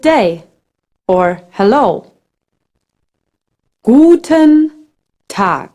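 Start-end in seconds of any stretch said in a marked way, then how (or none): 3.8-5.7 Guten Tag said slowly, each sound clear; slow teaching model. Shown as a demonstration. Teacher models the greeting for a beginner, slow and clear. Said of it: "Pay attention to how G is pronounced".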